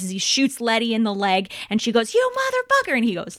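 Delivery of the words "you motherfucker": angry yell. Retold or imitated. Shot Letty curses at Dom.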